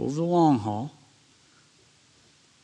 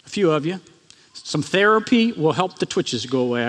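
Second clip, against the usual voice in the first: normal talking voice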